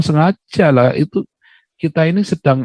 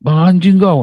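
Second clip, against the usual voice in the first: mock insult; 6 semitones higher, 3 dB louder, slower than usual. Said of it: "nggak boleh saya ngomongin begitu".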